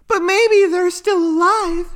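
very high little character voice; squeaky-high, offering hope; hopeful friend. A narrator's reading. Isotopia consoles Yuno about missing parents, high hopeful voice.

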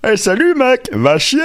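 high, brash dubbed-comedian voice; Eddie Murphy French voice. Retold or imitated. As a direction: throw it up high like a cocky dubbed hero. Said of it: "imiter la voix de la voix d'Eddie Murphy en français". (voix française d'Eddie Murphy, aiguë et frondeuse)

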